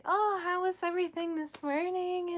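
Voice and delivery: sugary, high-pitched sing-song sweetness; killing with kindness. Retold or imitated. Mom answering her sulking daughter, killing her with kindness. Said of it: "I just killed her with kindness".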